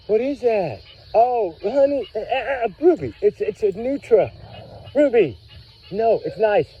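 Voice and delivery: high, animated calling to a dog; excited dog talk. Real, not acted. Owner telling Ruby it's a nutria, excited. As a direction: pitch it up to settle an excited dog.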